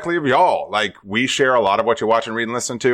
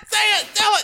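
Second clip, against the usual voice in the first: excited urging; much faster than usual. Real, not acted. Urging with high voice.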